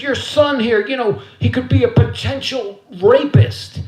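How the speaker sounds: high, loud imitation of a principal; hammed-up high-pitched accusation; accusing principal. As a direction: report the son to his mother in a put-on official voice. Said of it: "And the principal, Mister Pittman".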